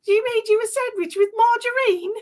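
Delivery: very high mock-British exclamation; hammed-up British lady aghast on the phone. Host and daughter re-enacting the TV scene, exaggerated British accent. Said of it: "And we're like".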